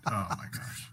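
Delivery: unvoiced, breathy oh my gosh; a whisper after the angry story; whispered exasperation. Real, not acted. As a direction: breathe it out in disbelief. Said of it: "I was just so mad".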